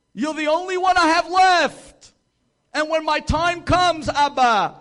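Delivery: high and loud, crying out to God; lonely old woman crying out her prayer; fervent pleading cry. Retold or imitated. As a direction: plead with all your heart, rising. Prayer rising to a plea.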